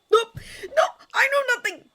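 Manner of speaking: very high, loud frantic voice; squealed, hurried cover-up; panicked denial. A narrator's reading. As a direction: blurt it out like a cornered secret-keeper. Cornered friend hiding a secret.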